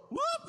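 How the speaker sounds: a high, loud whoop popping out; sudden whoop. Retold or imitated. Preacher mimicking an outburst with a high loud whoop. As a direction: let the sound fly out like a burst emotion.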